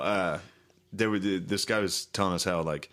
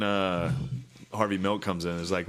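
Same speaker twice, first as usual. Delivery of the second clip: normal talking voice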